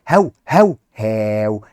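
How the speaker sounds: put-on playful woofing for kids; dog bark. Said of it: "كلب بيقول هاو".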